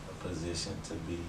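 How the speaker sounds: hushed, secretive voice; whispering. Real, not acted. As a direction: drop the voice to a hush. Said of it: "Why are you whispering?".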